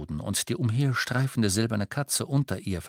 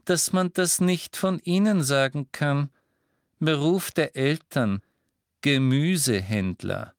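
Umbridge voice; 5 semitones higher, 4 dB louder than usual. A narrator's reading. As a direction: talk down to her like a stern official.

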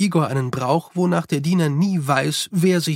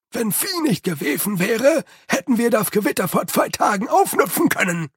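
same grim Igor voice, pitched up; grumbling servant; 6 semitones higher than usual. A narrator's reading. Igor blaming Lady Lejeune for the lost storm, grumbling. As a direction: blame her sourly.

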